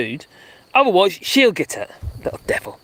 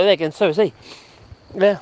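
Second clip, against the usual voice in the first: ordinary delivery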